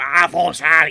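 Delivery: jab the words at them aggressively; aggressive devil speech. Acted. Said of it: "aggressively at you and going".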